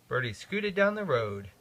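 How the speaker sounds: usual speaking voice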